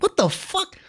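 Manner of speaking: high squeaky disbelief; mock bafflement. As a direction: let it jump way up, exasperated. Retells baffled reaction to accusations.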